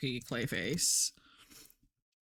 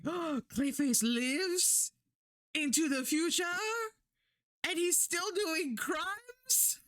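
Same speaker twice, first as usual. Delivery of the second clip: high, drawn-out excited gasp of disbelief; 10 semitones higher, slower than usual. Retold or imitated. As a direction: re-enact giddy, high-pitched astonishment, stretching the words. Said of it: "I got so excited".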